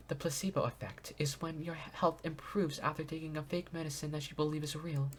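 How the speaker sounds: soft, polite princess reading; gentle princess voice. Acted. Voices Sonia character lines.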